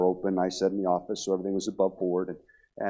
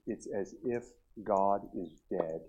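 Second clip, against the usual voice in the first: retold confession, very slow and hushed; soft, halting, heavy pauses between phrases; quiet despair; 2 semitones lower, 6 dB quieter than usual. Retelling a despairing woman's confession.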